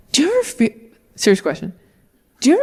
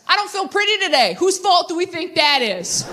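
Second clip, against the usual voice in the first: whiny accusation; 8 semitones higher than usual. Retold or imitated. Group text to dad and exes, high whiny pout. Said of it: "write in all caps".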